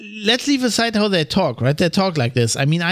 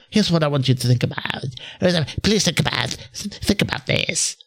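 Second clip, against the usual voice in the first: mocking vocal fry; 4 semitones lower than usual. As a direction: lower mock podcast host with a croaky fry trailing off. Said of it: "the vocal fry".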